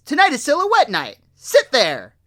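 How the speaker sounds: high, loud, fast nagging; scolding old mother. Retold or imitated. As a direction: scold him like a grown child. Agnes scolding son.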